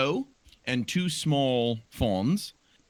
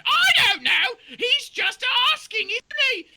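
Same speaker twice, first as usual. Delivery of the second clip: very high, louder, silly; a shrill cartoon doe; high-pitched doe voice; squeaky deer voice; 9 dB louder than usual. Doe answers buck.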